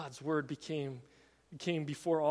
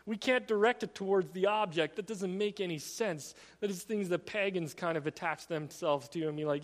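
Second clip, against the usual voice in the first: ordinary delivery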